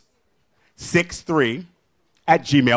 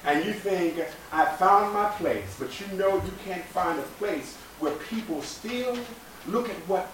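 slow, measured spoken-word poem; poetry reading; 3 semitones higher, 7 dB quieter, slower than usual. Acted. Reads Nikki Giovanni poem.

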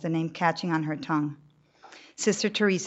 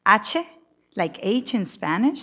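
put-on puzzled old nun; 5 semitones higher, 7 dB louder, slower than usual. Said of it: "says Sister Teresa".

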